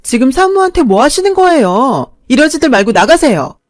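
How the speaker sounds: loud, high firm order; stern nurse. Acted. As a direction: order them out firmly. Nurses stop the fight.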